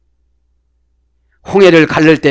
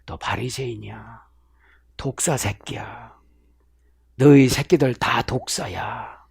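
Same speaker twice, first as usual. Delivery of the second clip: low, hissing whispered insult; hushed rebuke; 4 semitones lower, 8 dB quieter than usual. Acted. Jesus condemns the Pharisees as a brood of vipers, low and hushed. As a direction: spit the words out under the breath.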